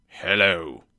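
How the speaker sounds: low, put-on gruff greeting; low-pitched pretend crossness; mock-angry shout. A narrator's reading. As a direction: feign a stern boss's anger. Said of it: "shouted Scrooge, trying to make his voice sound as angry".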